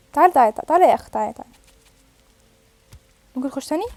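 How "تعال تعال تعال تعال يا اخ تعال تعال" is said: loud insistent calling out; hungry, urgent calls. Real, not acted. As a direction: shout for the animal to come. Hungry, calling animal over.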